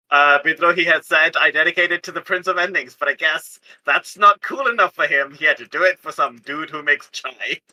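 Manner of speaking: ordinary talk, nothing marked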